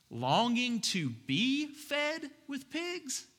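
high, slow incredulous question; appalled disbelief. Pretend. Pastor repeats the verse, aghast, high and slow. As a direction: ask it like it's absurd.

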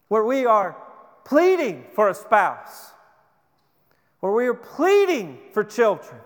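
a preacher pressing the point to his church, full force; fervent preaching. Acted. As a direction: drive the appeal home to the congregation.

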